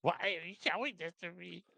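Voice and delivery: high squeaky tiny creature voice; helium voice. Acted. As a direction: pitch it up like helium. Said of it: "Can you do some helium voice for us".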